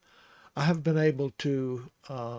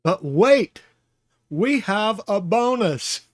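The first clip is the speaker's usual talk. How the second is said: But wait burst out loud and high; playful infomercial hype, slowing down; mock announcer; 6 semitones higher, 9 dB louder, slower than usual. Teacher teases a bonus topic to viewers, hammed-up announcer hype. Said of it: "hamming up a bonus announcement".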